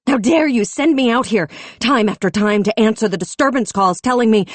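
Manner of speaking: high loud blaming of the chief; furious accusation. A narrator's reading. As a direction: hurl it at him. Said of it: "her anger like lightning".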